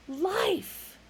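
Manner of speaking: excited plea, falling; urging. A narrator's reading. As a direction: push the word out eagerly. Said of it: "urged Fook".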